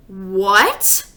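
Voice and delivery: loud stunned what; disbelief. Acted. Mother hearing the commercial is Fudge, stunned and loud. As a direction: gasp it out in disbelief. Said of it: "like she couldn't believe it".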